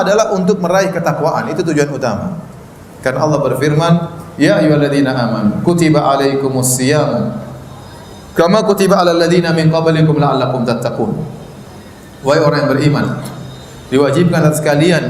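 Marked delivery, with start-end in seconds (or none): 4.4-11.1 measured Quranic chant; Quran recitation. Acted. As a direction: recite the verse with reverence.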